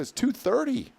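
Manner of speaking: very high, squeaky disbelief; high-pitched mock incredulity; incredulous. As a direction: retell astonishment at the late hour. Retold disbelief at late-night eating.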